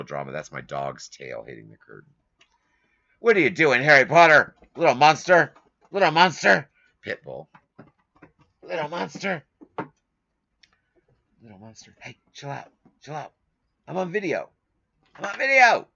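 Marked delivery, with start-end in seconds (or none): 3.2-6.7 high, loud pet-scolding voice; talking to the dog. Real, not acted. 8.6-15.9 high pet voice, pleading with the dog to calm down; talking to the dog. Real, not acted.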